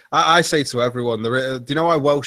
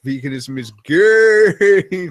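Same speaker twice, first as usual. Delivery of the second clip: drawn-out, louder deadpan delivery; slow deadpan quote; 5 semitones higher, 6 dB louder, much slower than usual. Retold or imitated. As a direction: stretch every word of the joke flatly. Quoting YouTuber's joke.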